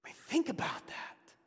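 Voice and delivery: a sudden breathy hush; hushed whisper. Real, not acted. Pastor whispering to the congregation to ponder God's call. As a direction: whisper it, drawing them in.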